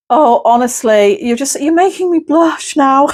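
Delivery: high, giggly camp voice, half-laughing; coy, flattered and tickled pink; bashful John Inman. Retold or imitated. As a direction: camp spirit guest blushing at the compliment, high and giggly. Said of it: "He's saying".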